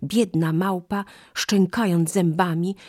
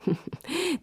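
short high giggle; a quick sly giggle; high laugh; 14 semitones higher, 5 dB quieter than usual. Acted. Monkey laughing at her trick, a high giggle.

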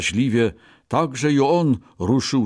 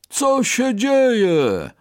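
very slow, high, louder elephant call; trumpeting elephant; 13 semitones higher, 4 dB louder, much slower than usual. Acted. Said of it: "zatrąbił słoń". (trąbiący słoń)

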